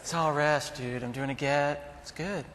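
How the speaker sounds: lightly voiced Rastafarian; a hint of laid-back Rastafarian accent; mock Rastafarian, only lightly put on. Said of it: "That was my Rastafarian voice".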